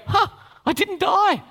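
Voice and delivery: high, surprised "huh, I didn't die"; surprised sinner. Retold or imitated. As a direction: voice the sinner's smug relief. Sinner voiced inside retold thought.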